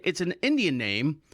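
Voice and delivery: ordinary talk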